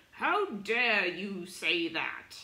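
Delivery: slow, loud, indignant "How dare you"; hammed-up offended snob, drawn out and loud; outraged traditionalist. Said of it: "a traditionalist sitting right next to it".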